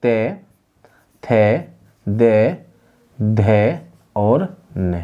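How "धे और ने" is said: धे and ने drawn out very slowly; teacher stretching धे and ने; slow syllable demo. Shown as a demonstration. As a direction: spell out धे और ने slowly for the class. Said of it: "दंत से बोले जाने वाले कौन से थे".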